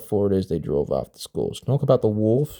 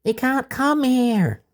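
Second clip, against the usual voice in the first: put-on high, slow small child's voice; a child explaining earnestly in a high voice; slower than usual. A narrator's reading. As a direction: speak up high like a little kid. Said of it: "Megan was close to tears".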